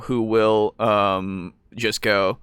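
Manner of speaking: ordinary delivery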